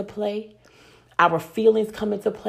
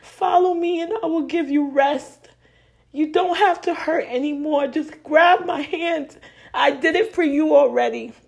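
high, louder, fervent voice of God calling; God pleading; 7 semitones higher, 4 dB louder than usual. Acted. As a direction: plead as God reaching out a hand. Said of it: "he's saying".

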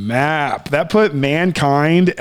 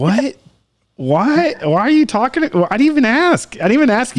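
higher-pitched, baffled mock comeback; indignant retort; 7 semitones higher than usual. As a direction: push back at an unasked opinion, acted out. Acts out reply to unasked opinion.

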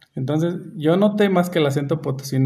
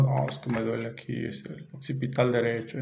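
softer, mock-formal patient voice; posh fake-serious; 3 semitones lower, 9 dB quieter than usual. Mocking how patients sound posh to a doctor, softer and mock-serious. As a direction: put on airs for the doctor. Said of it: "tratas de mamonear".

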